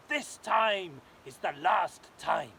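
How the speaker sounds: slow, loud, stretched royal bellow; a king at the end of his patience; angry king. Acted. King rages about the dragon, slow loud declaration. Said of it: "said the king".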